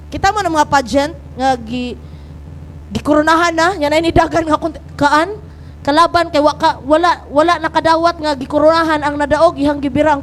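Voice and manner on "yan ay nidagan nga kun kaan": ordinary talk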